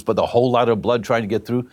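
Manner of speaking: usual speaking voice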